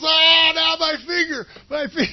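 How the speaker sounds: high mock yell of pain; finger pain shout. Preacher retelling movie, soldier yelling over broken finger. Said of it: "He goes".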